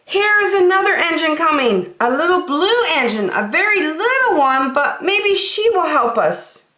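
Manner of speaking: high, excited clown calling out; hopeful call. Acted. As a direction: point out the new engine eagerly. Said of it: "the little clown called out".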